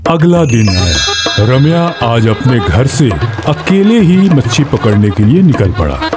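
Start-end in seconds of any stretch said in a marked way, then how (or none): none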